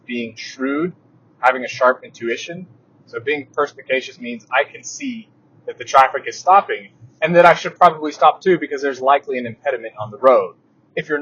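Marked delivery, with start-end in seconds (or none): none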